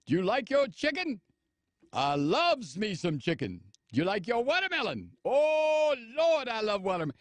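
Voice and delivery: hammed-up caricature of Southern minstrel speech; mock minstrel dialect. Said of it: "we're gonna talk like this".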